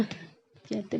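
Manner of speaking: usual speaking voice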